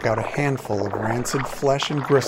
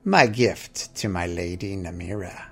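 put-on a broken old pirate voice, menacing and unhurried; rasping orc captain; 5 semitones lower than usual. A narrator's reading. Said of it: "she rasped".

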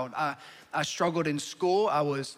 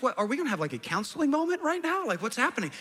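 mocking wife; 6 semitones higher, faster than usual.

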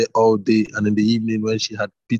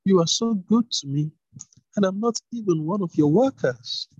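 put-on higher young woman, overwhelmed; grateful Ruth; 9 semitones higher than usual. A narrator's reading. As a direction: marvel at his kindness as the humble girl. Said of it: "she replied".

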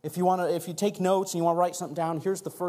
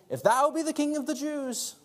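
high-pitched scripture taunt; mocking; 11 semitones higher than usual. A narrator's reading. Reading the soldiers' words to Jesus, mocking. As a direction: lift the voice into a read-out jeer. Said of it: "the soldiers also mocked him".

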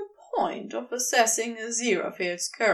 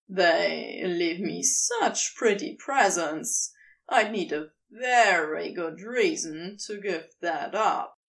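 lazy serpent; 6 semitones lower than usual. A narrator's reading. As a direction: purr it out like a smug serpent. Crowley voiced by narrator.

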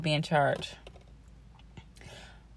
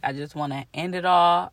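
usual speaking voice

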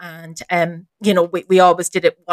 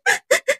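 high, hammed-up sobs; short squeaky fake crying; mock sobbing. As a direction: imitate a man blubbering. Imitates the man crying.

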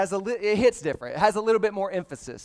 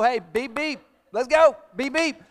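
high, playful beep beep of an impatient horn; mock car horn beeps; 5 semitones higher, 4 dB louder than usual. Pastor mimicking Florida drivers honking at a green light, high mock horn beeps. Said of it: "They're gonna let you know".

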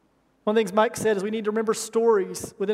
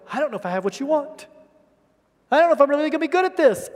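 nervous reply; 4 semitones higher, 3 dB louder, faster than usual. Retold or imitated. Retelling his own nervous reply.

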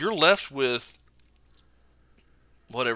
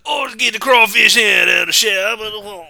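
high, slow put-on Cajun drawl; hungry swamp Cajun accent, hammed up; starving Cajun voice; 8 semitones higher, 7 dB louder than usual. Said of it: "How would they sound?".

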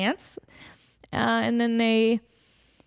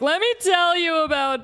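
mock gossip tease; 8 semitones higher, 5 dB louder than usual. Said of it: "I'm like".

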